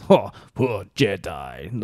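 slow, lightly voiced gloating crime lord; mocking Jabba. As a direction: sneer at the poor Jedi, slowly. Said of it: "he laughed at him and he said".

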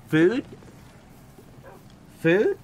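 high, loud rising question; perky upward-swooping offer; calling a pet. Real, not acted. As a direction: tempt a dog with one word. Offering food to the dog.

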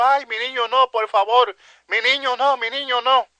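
high, pleading cry of a mother, imitated. Preacher voices mothers begging soldiers for their babies, pleading.

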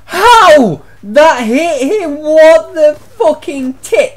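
high, loud, stretched outrage; incredulous shriek. Real, not acted. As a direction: squeal it out, outraged the shot hit him. Disbelief at a kill.

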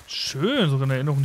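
ordinary talk

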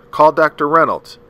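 loud, high urgent order; a father's sharp urgent call; sharp command. A narrator's reading. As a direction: bark it out sharply. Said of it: "Atticus's voice said sharply".

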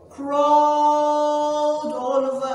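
"crawled" stretched on one long high note; slow, sing-song emphasis on "crawled"; drawn-out storytelling. Acted. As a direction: draw out CRAWLED slowly, almost sung. Dramatizing ants crawling in story.

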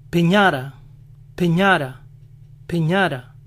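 piñata said as peñara, American accent. Shown as a demonstration. Showing how Americans say piñata, American accent. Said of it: "Piñata lo dicen".

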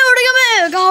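sudden high, loud cry of surprise; startled yelp. Real, not acted. Gamer shocked as his bird flies off, surprised yelp. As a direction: caught off guard, yelp it.

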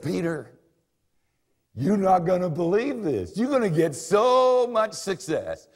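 high, loud, eager good news; excited promise. Retold or imitated. Jesus answering Peter, high and loud with joy. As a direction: tell him like the best surprise ever.

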